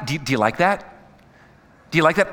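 ordinary talk

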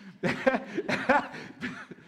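breaking into a high laugh; real laugh. Real, not acted. Preacher amused by his cookie joke, real high laugh. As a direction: crack up at your own joke.

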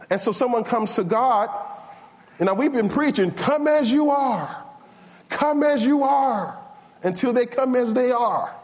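high-pitched, fervent pulpit delivery; impassioned preaching. Real, not acted. Preacher challenging the congregation about judging clothes, impassioned.

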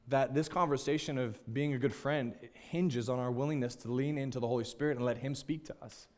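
ordinary talk, nothing marked

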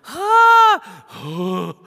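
sudden loud high yelp of shock; startled gasp. Acted. Disciples startled by Jesus calming the storm, loud gasp. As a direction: cry out in sudden alarm.

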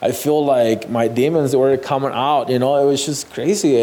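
ordinary talk

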